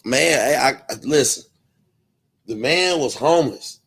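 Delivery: usual speaking voice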